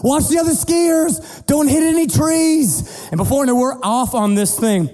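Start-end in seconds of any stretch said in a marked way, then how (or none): 0.0-2.8 yelled commands. Retold or imitated. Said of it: "Dad just starts yelling commands".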